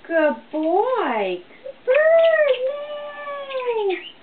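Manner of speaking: squeaky high praise for a bird; sing-song praise for a clever bird; high pet praise. Real, not acted. Owner praising her parrot.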